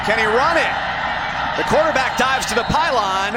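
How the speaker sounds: rising touchdown shout; excited call. Real, not acted.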